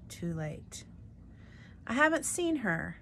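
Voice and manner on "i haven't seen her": raised, higher voice; shouting. A narrator's reading. Luis shouting in the kitchen. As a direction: call it out loud. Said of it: "Luis was shouting".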